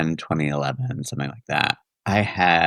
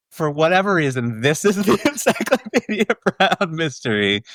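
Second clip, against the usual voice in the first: laughing; 8 semitones higher than usual. Real, not acted. Laughing about the memory.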